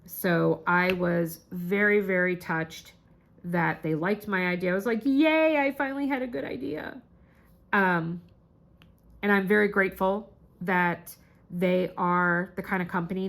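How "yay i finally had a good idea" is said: high, pleased little celebration; playful high-pitched yay; happy cheer. Retold or imitated. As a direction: cheer yourself on in a raised pitch. Celebrates her own idea.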